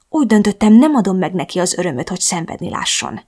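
ordinary delivery, nothing marked

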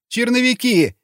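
high-pitched panicked reply; frightened answer. A narrator's reading. Beaten prisoner answers interrogator, frightened. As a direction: answer at once in a high, scared voice.

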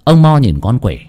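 ordinary delivery

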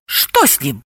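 high, excited little-animal chatter; lightly voiced nervous gossip; timid giggly guessing. A narrator's reading. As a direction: wonder aloud, pitch climbing. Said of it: "пугливо хихикали и гадали".

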